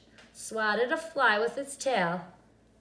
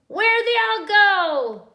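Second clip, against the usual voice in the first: loud, high, slow shout; high, loud witch shout at the duck; 11 semitones higher, 12 dB louder than usual. A narrator's reading. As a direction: yell it across the yard. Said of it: "Gretch shouted to a duck".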